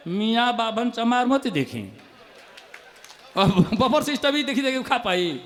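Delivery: normal talking voice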